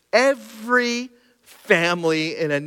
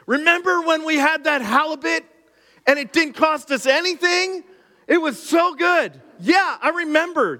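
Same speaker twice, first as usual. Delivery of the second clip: lampoon them as silly dreamers, pitched way up; mock nostalgic Israelite; 9 semitones higher than usual.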